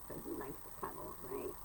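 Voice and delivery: soft quick whisper to the cat. Real, not acted. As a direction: whisper it close and fast.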